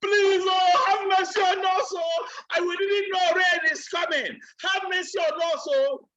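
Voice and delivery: high, wailing mock plea; crying out for mercy. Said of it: "Those people started crying and shouting".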